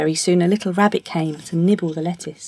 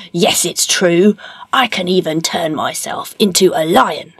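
boastful ogre; 3 dB louder than usual. Acted. Ogre showing off to Puss, boastful.

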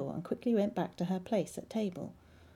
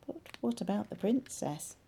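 usual speaking voice

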